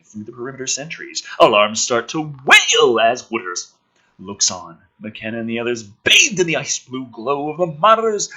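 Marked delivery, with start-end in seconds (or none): none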